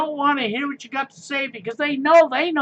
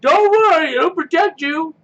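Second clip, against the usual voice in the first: mocking, higher and louder don't worry; sarcastic reassurance; 6 semitones higher, 5 dB louder than usual. Mocking mask wearers with fake reassurance, sarcastic. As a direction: fake comfort dripping with sarcasm.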